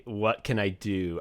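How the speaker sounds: usual speaking voice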